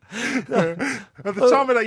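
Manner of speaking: laughing through the words, slow and high; giggling as he starts again. Real, not acted. Laughing to his co-host about old times. Laughing while retelling youth.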